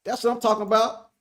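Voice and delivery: high, hammed-up rallying cry; playful deluded confidence; delusional Cowboy fan. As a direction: salute the fellow believers with mock pride. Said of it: "be a delusional Cowboy fan".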